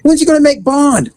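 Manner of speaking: high-pitched imitation of a demanding child; pushy little girl. Little girl asks officer about Daddy's bond, insistent. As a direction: press for an answer in a kid's high voice.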